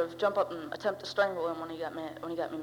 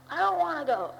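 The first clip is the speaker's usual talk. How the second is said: whiny refusal; 10 semitones higher, 7 dB louder than usual. Retold or imitated. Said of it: "he told me".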